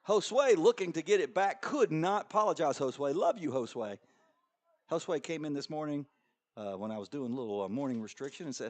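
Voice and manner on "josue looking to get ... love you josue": higher, louder live call; excited play-by-play. Real, not acted. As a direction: ride the action as it happens. Live call of goal chance.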